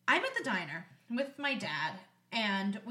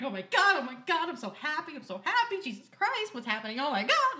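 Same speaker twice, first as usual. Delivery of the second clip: giddy retold gushing, mostly pitched up; freaking out; 2 semitones higher, 4 dB louder than usual. Best friend hearing engagement news, freaking out with joy. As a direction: gush in happy, breathless-sounding disbelief. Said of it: "I'm freaking out".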